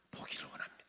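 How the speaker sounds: soft whispered request; hushed reply. Retold or imitated. Blind man answering Jesus' question, hushed whisper.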